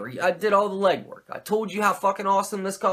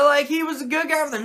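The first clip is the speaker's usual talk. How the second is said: normal talking voice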